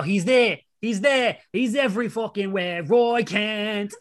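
chanting the chorus in a bad British accent; football terrace chant, British accent. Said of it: "Excuse the terrible British accent".

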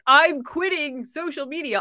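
loud, declaring it in caps; all caps. Retold or imitated. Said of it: "all caps, very [name] style".

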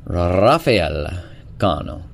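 "Rafael" with a long trilled R and swooping tune; showy rolled R. Shown as a demonstration. Host savouring guest's name, showy rolled R. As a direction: roll the R hard on "Rafael", drawing it out. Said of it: "I just like saying it like that".

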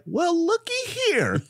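high, drawn-out comic marveling; playful high-pitched marvel; mock delighted discovery. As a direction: stretch it out high, hammed-up and pleased. Delighted discovery in retold story.

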